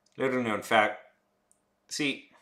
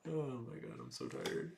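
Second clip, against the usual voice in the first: ordinary talk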